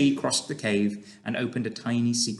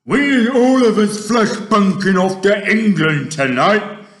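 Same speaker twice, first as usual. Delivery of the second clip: loud, slow, menacing giant voice; big greedy giant boast; brutish giant; 11 semitones higher, 13 dB louder, slower than usual. Acted. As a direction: gloat like a hungry monster. Narrator voices the Fleshlumpeater.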